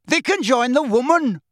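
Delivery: loud, high crowd shout. A narrator's reading. Dun-coloured centaur condemning captives, shouting. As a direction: shout out the verdict. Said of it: "a dun-coloured centaur shouted".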